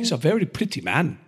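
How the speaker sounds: put-on light northern English accent; a quick northern English accent. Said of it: "I tried to do a, uh, northern voice there".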